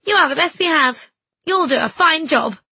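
high, loud and eager; encouraging. A narrator's reading. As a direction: lift her up with praise. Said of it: "Missus Quayle called".

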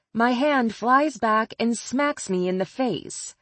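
plain reading voice

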